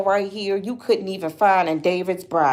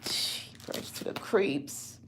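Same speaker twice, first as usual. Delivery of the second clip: a breathless whisper to herself; whispered exasperation; 4 semitones lower, 14 dB quieter, slower than usual. Real, not acted. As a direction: mutter it under your breath, worn out. Overwhelmed by the lost dress.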